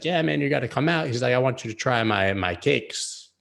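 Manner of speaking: a half-hearted Jamaican dealer voice; bad Jamaican accent. Retelling the weed seller, mock Jamaican accent. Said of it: "That was not a Jamaican accent".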